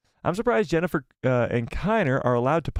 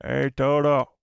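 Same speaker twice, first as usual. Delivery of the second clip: Cad Bane impression; slower than usual. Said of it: "I wanna get good at impressions".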